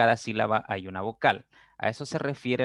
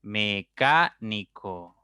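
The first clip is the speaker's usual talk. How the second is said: said slowly; much slower than usual. Shown as a demonstration. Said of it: "cuatro sílabas".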